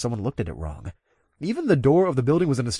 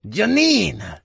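cheerful, high, loud greeting; jolly professor; 9 semitones higher, 5 dB louder than usual. A narrator's reading. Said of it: "I heard Hansen's jolly voice".

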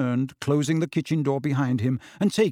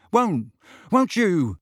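slow, higher, halting question; uncertain; 6 semitones higher, 4 dB louder, slower than usual. A narrator's reading. Boy checking with his friend, uncertainly. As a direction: ask it with doubt. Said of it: "he added uncertainly".

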